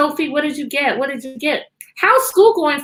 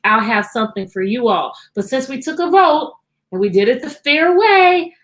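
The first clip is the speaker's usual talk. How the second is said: normal talking voice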